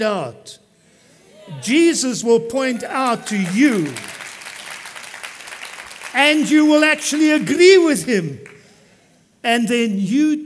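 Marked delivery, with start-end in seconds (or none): 1.6-10.4 rising preaching. Real, not acted. Preaching climax builds.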